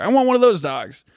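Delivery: a chatty driver spotting a dog, voice jumps up; excited driver voice. Retold or imitated. Driver spots someone walking dogs and asks eagerly in a high voice. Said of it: "He goes".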